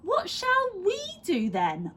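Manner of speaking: put-on high girl's whine; child voice. A narrator's reading. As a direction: ask like a bored little girl. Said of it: "asked Laura".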